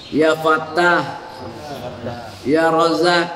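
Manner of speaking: each divine name drawn out slowly and evenly; slow dhikr recitation. Shown as a demonstration. Preacher teaches the wirid to the women, slow chant. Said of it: "Perhatikan baik-baik".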